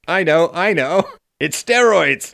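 loud, high laughing eureka; mock revelation. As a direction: shout it like a big discovery.

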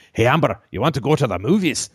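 lightly done Scottish asking Amber out; a hint of Scottish brogue; mock Scottish accent. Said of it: "Scottish [name]".

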